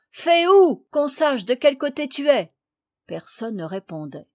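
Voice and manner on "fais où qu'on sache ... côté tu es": loud, higher-pitched call; raised voice, calling for a sign. A narrator's reading. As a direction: shout to someone lost in the woods. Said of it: "criait-on".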